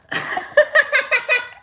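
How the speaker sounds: high, loud burst of laughter; big laugh. Real, not acted. Host cracks up at a chat joke, high laughing. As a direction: crack up helplessly, high-pitched.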